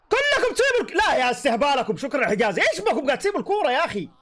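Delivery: angry yell. Real, not acted. Yelling at his defenders.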